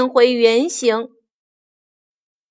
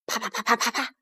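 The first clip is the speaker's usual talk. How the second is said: quick, light, quiet pattering; soft patter of blows; 10 dB quieter, much faster than usual. A narrator's reading. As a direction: imitate distant blows very softly. Imitated blows, first round.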